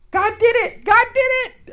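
high, loud cry of joy; joyful shout. Retold or imitated. Tells his wife Irene the news, shouting for joy. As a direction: proclaim it with overflowing joy.

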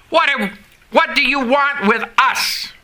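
ordinary talk

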